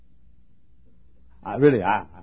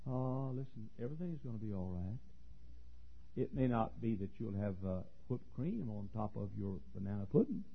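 gentle reassurance; 13 dB quieter than usual. Real, not acted. Soft comfort after the loud preaching.

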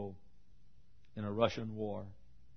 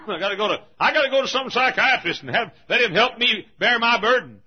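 high, loud voice of a burdened objector; loud protest; 11 semitones higher, 13 dB louder than usual. Acted. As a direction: plead it loudly from the pulpit.

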